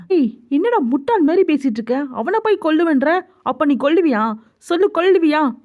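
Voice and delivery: incredulous, high-pitched scolding; angry challenge. Acted. Friend challenging Jungkook's threat to kill, angry and disbelieving. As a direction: throw the threat back as a heated question.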